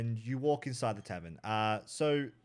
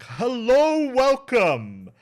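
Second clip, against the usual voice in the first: tavern keeper voice; 2 semitones higher, 9 dB louder than usual. Acted. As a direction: greet them like an overjoyed host. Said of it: "He's like".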